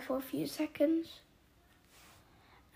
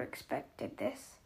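low worried voice; 8 semitones lower, 8 dB quieter, slower than usual. A narrator's reading. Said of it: "in a low, worried voice".

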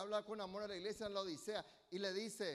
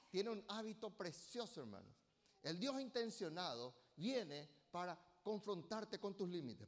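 ordinary talk

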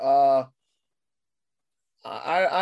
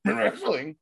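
high, laughing mock outrage at the word wrestling; a hammed-up Vince McMahon scoffing at the word; 7 semitones higher, 4 dB louder, slower than usual. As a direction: squeal it in disbelief like an outraged old boss. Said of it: "if somebody says wrestling".

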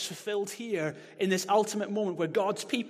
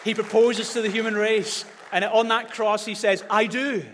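louder, higher, building proclamation; preaching at full force; 6 semitones higher, 7 dB louder than usual. Acted. Preacher proclaiming Jesus's proposal, loud and high. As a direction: proclaim it to the crowd.

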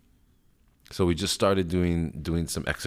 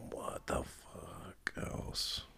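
whispered aside; 3 semitones higher, 13 dB quieter, much slower than usual. Real, not acted. Muttered aside to himself.

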